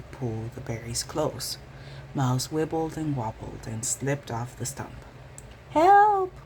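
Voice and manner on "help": high, loud squeaky cry for help; yell. A narrator's reading. Mouse dangling from a bush, loud high yell. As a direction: shriek it as she dangles. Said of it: "yelled Mouse".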